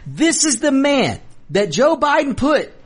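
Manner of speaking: ordinary talk, nothing marked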